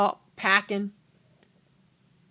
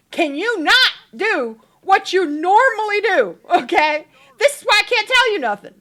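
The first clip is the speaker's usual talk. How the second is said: high, loud scolding; hammed-up fed-up complaint; acted exasperation; 8 semitones higher, 6 dB louder than usual. Wife to overreacting husband, loud exasperated scolding.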